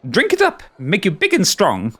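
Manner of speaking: high, giddy creature delight; creepy giggly voice. Acted. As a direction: coo over him like a mad collector.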